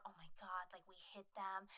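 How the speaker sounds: stunned near-whisper, imitated; devastated hush. Kids in boat realise the crash, hushed devastation. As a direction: drop to a very soft, low mock-shocked whisper. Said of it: "kind of just, like, devastated".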